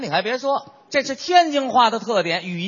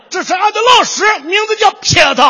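loud, much higher booming Shandong accent; 11 semitones higher, 8 dB louder, faster than usual. Shown as a demonstration. Imitating a Shandong student introducing the teacher, loud Shandong accent. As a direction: belt it out Shandong style with 俺. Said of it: "山东人叫的最响亮".

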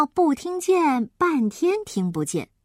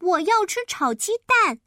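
put-on child's yelling; 8 semitones higher than usual. A narrator's reading. Child demands fried eggs from deaf grandma, high mock yell. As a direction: cry out for it in a high, insistent child voice. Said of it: "于是他指着嘴大叫".

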